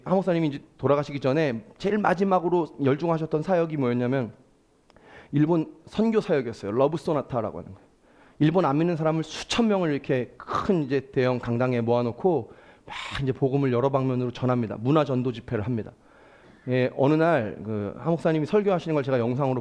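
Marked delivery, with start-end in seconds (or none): none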